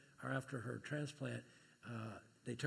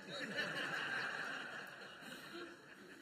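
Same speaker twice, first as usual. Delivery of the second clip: breathless laugh; 14 semitones higher, 4 dB quieter than usual. Real, not acted. Laughing in the middle of the sermon.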